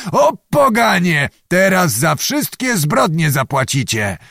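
yelling. A narrator's reading. Zagłoba threatens frightened peasants with his sabre, yelling angrily. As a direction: rail at them like a blustering nobleman. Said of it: "począł wrzeszczeć".

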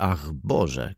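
usual speaking voice

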